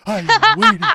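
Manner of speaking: loud, high laughing taunt. Pretend. Daring the other country, shouting high and laughing. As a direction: shout the challenge through laughs.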